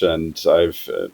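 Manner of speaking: ordinary talk, nothing marked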